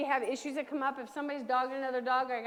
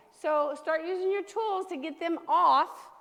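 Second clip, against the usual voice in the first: usual speaking voice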